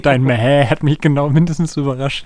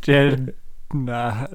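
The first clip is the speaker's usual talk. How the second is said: ordinary delivery, nothing marked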